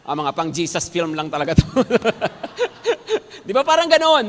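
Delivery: normal talking voice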